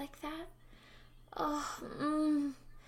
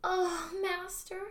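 high gasping; 7 semitones higher than usual. Acted. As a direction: voice climbing in pleasure.

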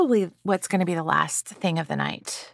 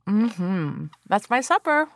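crunching a pickle; 4 dB louder, slower than usual. Real, not acted. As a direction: mouth full of pickle. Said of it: "I'm gonna bite into the pickle".

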